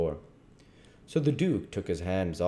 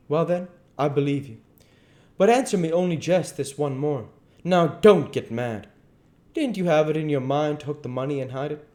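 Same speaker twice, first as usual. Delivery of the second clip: higher, cautious questioning; placating king; 9 semitones higher, 7 dB louder than usual. Acted. King asking the duke one more question, placating. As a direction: coax him without backing down.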